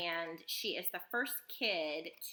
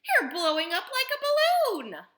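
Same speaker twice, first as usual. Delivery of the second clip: high, loud alarmed screech; horrified mother's shriek; 12 dB louder than usual. A narrator's reading. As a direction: screech the whole line. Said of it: "screeched Missus Bro-, Beauregard".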